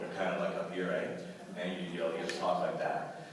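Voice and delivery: low Canadian accent from back of throat; Canadian accent, throaty. Shown as a demonstration. Actor showing real Canadian accents, back-of-throat Canadian. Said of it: "in the back of the throat".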